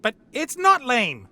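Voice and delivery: insist, drawing it out; indignant protest. Acted. Hatter protests the child's insult.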